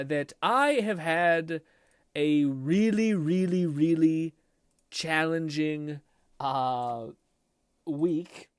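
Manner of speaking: each word drawn out slowly, leaning on really; slow emphasis. Real, not acted. Streamer telling chat about his hard week, slow and emphatic. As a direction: stretch it out to stress how hard it was. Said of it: "this is all to say".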